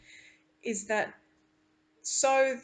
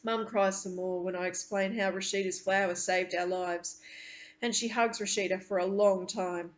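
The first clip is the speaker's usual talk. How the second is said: usual speaking voice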